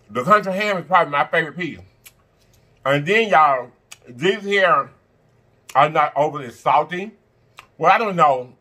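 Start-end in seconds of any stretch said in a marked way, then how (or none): none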